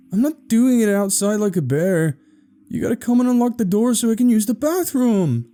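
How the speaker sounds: put-on high-pitched, protesting kid voice; worked-up little brother, high and insistent; desperate young boy. A narrator's reading. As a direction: plead urgently through a locked door. Desperate boy pleading at locked door.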